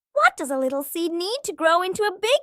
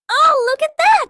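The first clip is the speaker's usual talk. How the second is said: excited; 7 semitones higher, 7 dB louder than usual. Acted. Excited reaction to splash.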